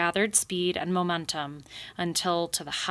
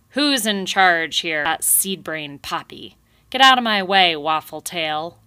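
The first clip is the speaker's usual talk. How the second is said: gruff yelling; 8 dB louder than usual. Acted. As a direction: shove past them yelling. Porcupine barging through beavers.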